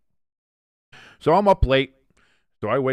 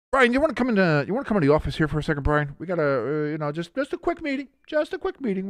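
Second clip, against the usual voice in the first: high, put-on coaxing voice; mock-casual, hurried and high-pitched invitation; boss summoning coach; 7 semitones higher, faster than usual. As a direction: play the boss luring someone into a meeting. Acting boss summoning coach to firing.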